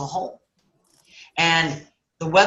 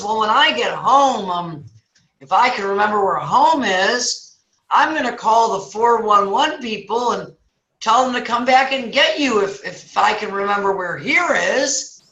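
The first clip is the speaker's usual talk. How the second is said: clueless forgetful passerby; 4 semitones higher than usual. Acted. Passerby offers to call for help, acted spacey voice. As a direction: play it spacey, stretching the ends.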